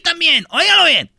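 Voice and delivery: más agudo, anuncio animado; llama la atención del público con fuerza; anuncio enérgico. Acted. (locutor llamando al público, animado)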